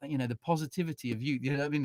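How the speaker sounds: ordinary delivery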